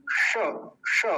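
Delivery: श made with the tongue curled to the palate; isolated, careful retroflex श; retroflex sha. Shown as a demonstration. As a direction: sound a lone retroflex "sha" for श. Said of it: "मूर्धा से".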